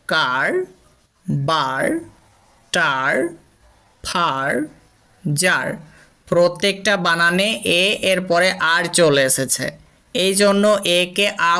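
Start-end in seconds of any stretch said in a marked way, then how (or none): 0.1-5.7 কার, বার, টার said with a long ar sound; example words said carefully. Shown as a demonstration. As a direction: model each word, lower and slow. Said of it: "যেমন". (আ ধ্বনিতে উদাহরণ শব্দ)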